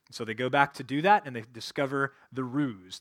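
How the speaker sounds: normal talking voice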